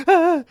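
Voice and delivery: short, high mock scream; freaked-out shriek. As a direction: imitate a terrified yelp. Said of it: "she's freaking out".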